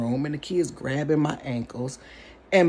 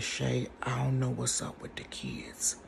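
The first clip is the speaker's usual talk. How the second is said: soft, low, whispered into the phone; frightened whisper; 5 semitones lower, 11 dB quieter than usual. Retold or imitated. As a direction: whisper so the kids don't hear. Scared phone call whisper.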